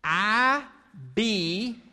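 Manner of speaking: slow, each word set apart, lean on be; slow emphatic demo. Shown as a demonstration. Pastor teaching children a motto, slow and deliberate. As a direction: model the phrase slowly for the kids to repeat. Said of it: "Can you say that with me?".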